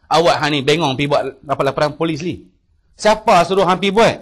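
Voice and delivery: mock rebuke of a younger brother; mild imitated scolding. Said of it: "Maki saya juga".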